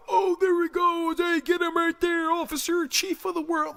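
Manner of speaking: very high, loud mocking cry; put-on squeal raising the alarm; mock alarm call. Mock bystander calling an officer after the killer, high squeal.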